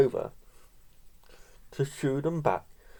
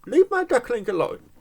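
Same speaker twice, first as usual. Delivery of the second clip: high protective mama duck; mother duck voice; 10 semitones higher than usual. Retold or imitated. Mother duck scolding a pestering dog, high. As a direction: shoo them off like a cross mother duck. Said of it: "she's like".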